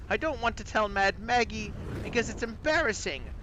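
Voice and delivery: high, aggrieved British voice; whistly British accent. Acted. Toothless former wizard tells of stolen tooth, high British accent. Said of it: "he whistles his S's as you hear them".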